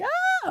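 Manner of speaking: high shrieking party squeal; excited squeal. Retold or imitated. Friends greeting her arrival with a squeal. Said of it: "Everyone's like".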